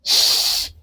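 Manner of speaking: soft hushed "ش" hiss, tongue near the palate; German ich sound. Shown as a demonstration. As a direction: say "ش" as an airy German ch, no voice. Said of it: "يا إما بيتنطق ش".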